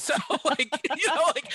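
talking through a laugh. Real, not acted. Laughing while telling the story.

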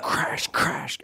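breathy whispered crash. Retold or imitated. Telling buddies how a deer came crashing in, whispered effect. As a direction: whisper the sound of the woods breaking.

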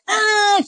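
short, high imitated toddler shriek; high "ah" imitating a screaming toddler; mock tantrum scream. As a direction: mimic a child's tantrum scream.

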